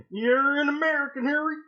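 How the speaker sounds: high-pitched hillbilly Hagrid announcing big news; hillbilly parody, high and drawn upward. Acted. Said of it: "redneck hillbilly".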